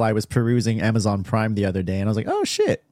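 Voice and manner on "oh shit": sudden high-pitched oh shit; surprised. Retold or imitated. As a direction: jump up in pitch, caught off guard. Retold discovering movie on Prime.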